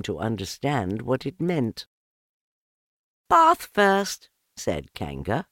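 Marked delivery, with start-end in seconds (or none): 3.3-4.3 higher, louder cheerful call; bright cheerful mother. A narrator's reading. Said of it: "in a cheerful voice".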